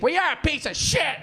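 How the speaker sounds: high-pitched irate lady impression; hammed-up high indignation; mock outraged heckler. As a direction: imitate a woman going bananas, way up high. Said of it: "this one lady went bananas".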